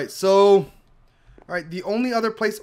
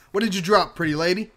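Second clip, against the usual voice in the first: ordinary talk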